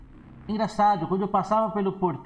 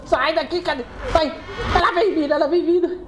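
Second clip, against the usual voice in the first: frightened high-pitched shout; panicked warning; 10 semitones higher than usual. Real, not acted. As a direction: warn everyone she is coming. Monster approaching again.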